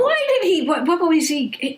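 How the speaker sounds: high-pitched stammering interruption, coming down as it goes; flustered disbelief. Acted. Listener cutting into the doll head story, flustered and incredulous. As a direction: burst in high, stumbling over the question. Cuts in, baffled by doll heads.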